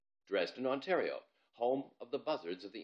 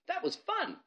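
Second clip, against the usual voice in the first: much higher, bright delight; thrilled girl. Acted. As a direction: gush with glee. Ivalo thrilled after jump.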